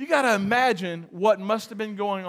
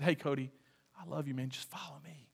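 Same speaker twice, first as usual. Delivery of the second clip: soft and much quieter, lower, gentle; tender invitation; 8 semitones lower, 17 dB quieter, faster than usual. Acted. Jesus gently inviting Matthew to follow him, tender and soft. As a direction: coax him kindly, almost under the breath.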